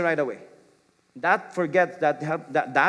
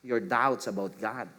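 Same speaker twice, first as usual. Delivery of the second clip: soft, low and tender; gentle answer; 4 semitones lower, 6 dB quieter than usual. Shown as a demonstration. Parent gently answering a child's doubts, softly. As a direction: gently offer to walk with them. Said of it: "A gentle answer would be".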